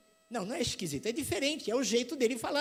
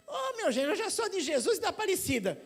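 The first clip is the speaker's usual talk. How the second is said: put-on higher, sweet old lady voice with a laugh; old mother-in-law; 7 semitones higher than usual. (sogra velhinha, aguda, rindo)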